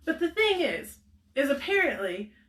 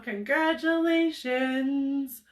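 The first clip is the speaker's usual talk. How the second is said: drawn-out congratulations; 4 semitones higher, much slower than usual. Real, not acted. Congratulating the winner.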